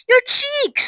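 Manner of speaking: high-pitched alarmed cry; mild scream. A narrator's reading. Mother spotting Violet's cheeks, high horrified cry. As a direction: squeal it in horror. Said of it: "screamed Missus Beauregard".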